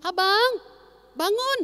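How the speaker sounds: lightly voiced mother calling her son up, rising; mother calling to wake. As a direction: call the boy out of bed like a mom. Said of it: "Mamanya bilang".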